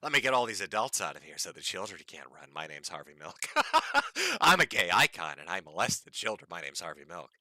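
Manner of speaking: low, hushed mock politician; Harvey Milk voice. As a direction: murmur it low and soft. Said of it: "He sounds like Harvey Milk".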